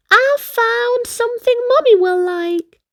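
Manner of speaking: put-on high, bright little boy; cheeky younger brother. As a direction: pipe it up like a small kid. Kingston's high voice.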